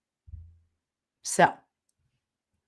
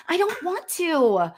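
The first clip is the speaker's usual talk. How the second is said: put-on a higher, childish whine; whiny protest; 8 semitones higher, slower than usual. Arguing with her spirit guides, whining. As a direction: protest like a sulky kid. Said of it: "we yell at my guides".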